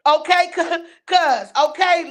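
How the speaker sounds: ordinary talk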